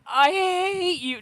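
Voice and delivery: high, loud, slow mock wail; hammed-up cry of betrayal; playful fake fury; mock hatred. Mock yells at enemy.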